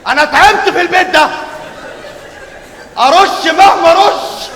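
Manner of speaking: loud, high, drawn-out lament; loud complaint. Acted. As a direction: wail it, exasperated. Uncle complains loudly.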